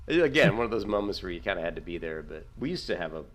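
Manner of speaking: usual speaking voice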